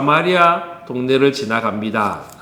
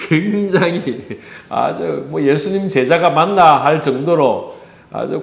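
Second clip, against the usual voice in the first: laughing while talking; amused chuckle. Real, not acted. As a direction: chuckle through the words in amused disbelief. Chuckles at his acted outburst. (웃음 섞인 말투)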